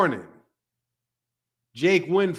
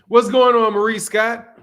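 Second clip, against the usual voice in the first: loud greeting; 5 semitones higher, 7 dB louder than usual. Real, not acted. Calling out a greeting.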